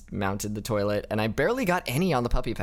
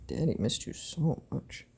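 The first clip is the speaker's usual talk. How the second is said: pet talk; 4 semitones higher, 5 dB quieter than usual. Real, not acted. Cooing to his cat.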